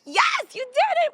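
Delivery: loud, very high celebratory shout; re-enacted burst of loud delight; excited cheer. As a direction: cheer them on at full pitch. Retold cheer over tied shoelace.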